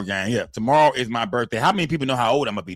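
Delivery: normal talking voice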